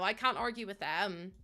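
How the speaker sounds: usual speaking voice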